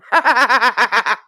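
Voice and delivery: loud burst of laughter. Real, not acted. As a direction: crack up loudly mid-conversation. Cracks up while reading message out.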